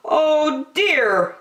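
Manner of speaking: high, kindly park keeper; a gentle, fussy park keeper; Percy's voice. A narrator's reading. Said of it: "said Percy".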